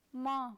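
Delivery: ma with a French nasal vowel; nasal syllable. Shown as a demonstration. Teacher adds M to the sound, nasal French ma. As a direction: let the vowel of ma ring through the nose. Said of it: "the letter M before this nasal sound".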